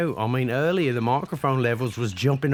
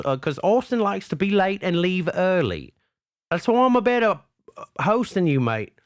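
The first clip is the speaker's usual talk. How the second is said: higher, hammed-up Aussie announcer boasting; rally the listeners for a new co-host; mock broadcast appeal; 4 semitones higher than usual.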